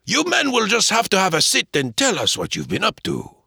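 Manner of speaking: put-on high French-accented shopkeeper; Laplace's voice. Laplace inviting them to sit, high French voice.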